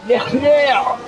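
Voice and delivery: high squeaky Leclerc; a hint of a shrieking kid fan; mock kid voice. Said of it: "as that kid in Saudi Arabia said".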